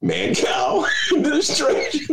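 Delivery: mock grand announcement; laughing shout. Real, not acted. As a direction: belt it out through laughter. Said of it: "loud laughing announcement".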